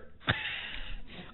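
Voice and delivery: high airy giggle; laugh. Real, not acted. Laughing at her "fear" confession to the room. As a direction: let a breathy laugh escape.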